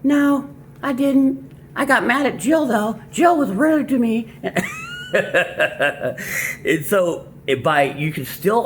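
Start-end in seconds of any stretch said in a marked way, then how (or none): 0.0-4.3 high, slow, whiny daughter voice; whiny girlish voice. Retold or imitated. The daughter complains to her dad about Jill, high and whiny. 4.4-6.6 high laugh. Real, not acted. Laughs after daughter voice.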